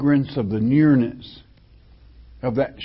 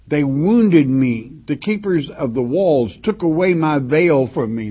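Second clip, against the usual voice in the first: louder, raised reading of the verse; scripture reading; 4 dB louder than usual. Acted. Preacher reads her mistreatment aloud, loud and declaiming.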